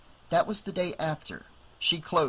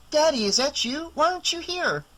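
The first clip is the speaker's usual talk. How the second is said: put-on much higher child's voice, eager; little girl; 12 semitones higher than usual. Daughter asks her father why he isn't home, high child's voice. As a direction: sound like a small girl on the phone.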